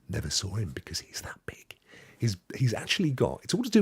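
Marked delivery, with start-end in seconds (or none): 0.1-1.7 hushed confiding whisper; secret whisper. Acted. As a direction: tell it like a secret only they should hear. Revealing Santa is tiny.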